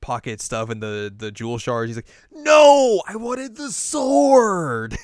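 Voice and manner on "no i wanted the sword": high, loud, drawn-out cartoon tantrum; exasperated mock rage. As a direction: wail it in hammed-up villain outrage. Villain rages at henchman's wrong loot.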